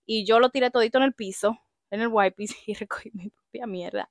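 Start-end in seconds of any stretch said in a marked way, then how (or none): 1.9-4.1 whispered with a laugh; embarrassed hushed confession; whispered aside. Real, not acted.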